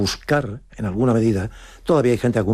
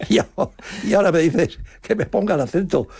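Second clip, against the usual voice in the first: high, mocking echo of the director; mock indignation; 9 semitones higher, faster than usual. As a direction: throw his request back at him in disbelief. (imitando con sorna al director)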